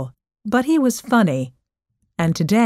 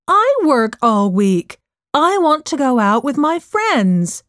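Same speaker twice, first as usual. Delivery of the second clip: raised, higher-pitched arguing voice; indignant retort; 6 semitones higher, 3 dB louder than usual. Acted. Daughter turns mom's argument back on her, indignant retort.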